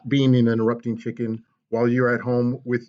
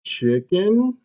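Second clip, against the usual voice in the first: ordinary delivery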